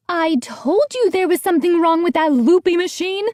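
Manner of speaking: high scolding housekeeper voice; voiced housekeeper. A narrator's reading. Housekeeper scolds the boy, high and scolding. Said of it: "she said".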